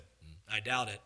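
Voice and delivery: low, soft skeptical murmur; a lazy low doubting hum; Doubting Thomas murmurs low and soft; doubtful Thomas. Retold or imitated. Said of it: "he probably said".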